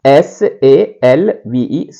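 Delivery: S A L V E spelled letter by letter, slowly; dictating letters to students; slow spelling. Shown as a demonstration. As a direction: spell out S A L V E one letter at a time. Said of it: "इसको लिखेंगे".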